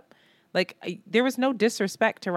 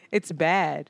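normal talking voice

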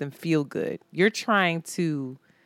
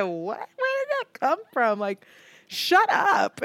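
mock shocked; 8 semitones higher, slower than usual. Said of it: "I said".

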